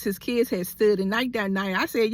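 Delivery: ordinary delivery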